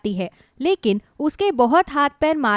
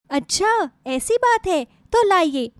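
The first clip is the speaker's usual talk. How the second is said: much higher, lightly eager voice; eager interest; 7 semitones higher, slower than usual. Acted. Visitor offering to help her neighbour cook, brightly eager. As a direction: perk up with a high-pitched offer to help.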